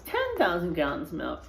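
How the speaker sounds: ordinary delivery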